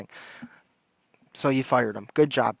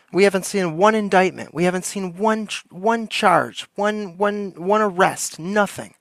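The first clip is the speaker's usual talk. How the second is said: heated rant; 5 semitones higher than usual. Real, not acted. Ranting to the chat about no arrests, heated. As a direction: get worked up about the missing arrests. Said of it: "I'm getting a little heated here".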